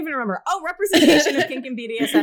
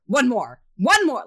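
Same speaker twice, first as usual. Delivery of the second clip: mock fitness-dom yell, building louder; trainer's yell; 3 semitones higher, 6 dB louder, slower than usual. Fitness dom drives a client through reps, mock yell. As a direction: bark out reps, second one higher and louder. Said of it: "yell at you if you".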